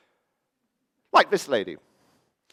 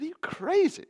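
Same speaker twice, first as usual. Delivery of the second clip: very high mock disbelief; incredulous. Mayor brushing off the climate suggestion, incredulous. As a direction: squeak it as an astonished retort.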